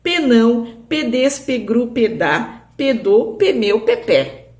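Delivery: teacher playfully reading the P-language line; língua do P. Shown as a demonstration. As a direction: read Penão pedes pegru pedá pedô pemeu pepé as a game code. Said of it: "ficou escrito aqui".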